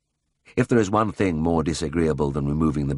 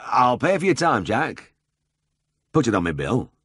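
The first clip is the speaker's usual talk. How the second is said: raised, higher voice calling out; calling after; 6 semitones higher than usual. A narrator's reading. Farmer calls after the leaving drover, raised. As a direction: call it after a departing man. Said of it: "called after him".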